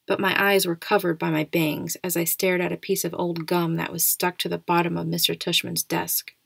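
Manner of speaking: ordinary delivery, nothing marked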